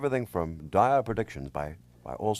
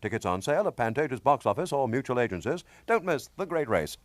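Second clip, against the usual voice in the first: melodrama narrator; 4 semitones higher than usual. Acted. Host reads the movie ad.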